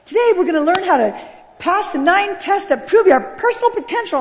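high, stretched-out preacher proclamation; young pastor. Retold or imitated. Young pastor opening his sermon, mock high proclamation. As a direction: announce it like a hyped motivational preacher. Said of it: "this young pastor comes on".

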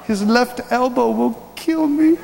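ordinary talk, nothing marked

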